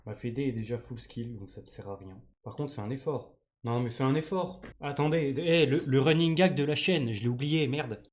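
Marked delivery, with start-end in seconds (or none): none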